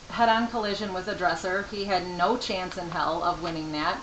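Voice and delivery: usual speaking voice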